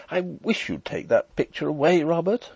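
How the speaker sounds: impatient. A narrator's reading. Wife wants the portrait removed, mildly impatient and high. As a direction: plead with mild exasperation, pitch lifted. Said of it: "uttered an exclamation of impatience".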